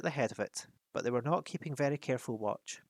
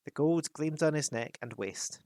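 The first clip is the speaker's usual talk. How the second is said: ordinary delivery, nothing marked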